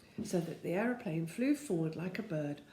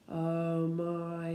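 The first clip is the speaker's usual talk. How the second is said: groaning; 2 semitones lower than usual. A narrator's reading. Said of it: "he groaned".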